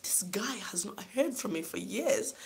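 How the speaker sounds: retold thought, whispered under the breath. Daughter recalling her private disbelief at father, whispered. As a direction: hush it like a secret aside.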